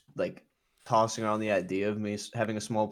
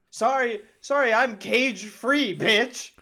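high, slow sassy hen; squeaky cage-free chicken sass; high silly egg voice; 10 semitones higher, 3 dB louder, much slower than usual. Pretend. Playing an egg character.

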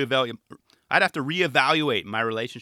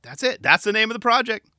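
excited realization; 9 semitones higher, 6 dB louder than usual. Retold or imitated. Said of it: "Sam's like".

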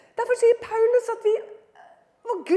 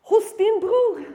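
usual speaking voice

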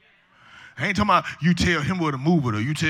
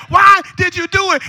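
hammed-up exasperated wail; acted outraged husband; 13 semitones higher, 9 dB louder than usual. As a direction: play the husband protesting in high-pitched disbelief. Husband in joke demands a reason.

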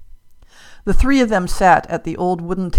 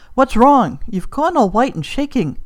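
higher-pitched, urgent worry; alarmed concern; 7 semitones higher, 5 dB louder than usual. A narrator's reading. Hugh finds his friend shaking, alarmed concern. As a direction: ask in alarm what has happened.

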